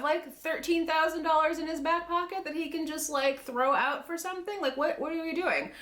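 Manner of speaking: incredulous. Real, not acted. Ranting in disbelief at the bill.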